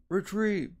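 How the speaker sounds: a commander's call to fall back; shouted order. Acted. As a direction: bellow the retreat to the ships. Calling the retreat.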